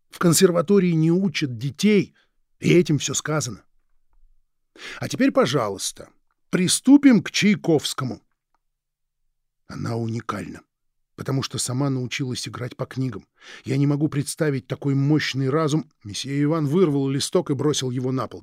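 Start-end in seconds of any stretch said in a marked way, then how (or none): none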